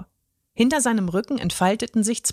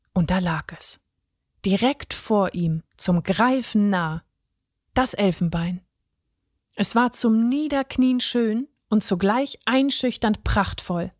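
ordinary delivery

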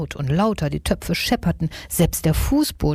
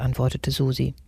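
usual speaking voice

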